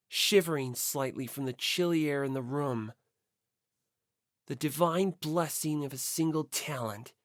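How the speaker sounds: normal talking voice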